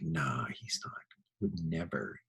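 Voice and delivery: calm yourself down under your breath; hushed reassurance. Retold or imitated. Teacher reassures himself.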